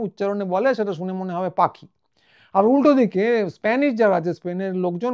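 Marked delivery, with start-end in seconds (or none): none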